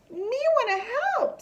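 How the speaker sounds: put-on high, childlike little girl voice; a small kid begging to join; little sister. A narrator's reading. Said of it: "says his little sister".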